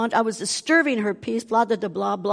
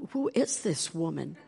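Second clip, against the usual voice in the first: panicked aside; 3 semitones lower, 9 dB quieter than usual. Retold or imitated. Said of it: "And I just panicked".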